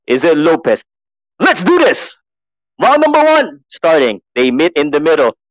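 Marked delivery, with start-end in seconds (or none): 1.4-4.2 high, energetic commentator shout-out; excited round call. Real, not acted. Commentator as round number one starts, excited call.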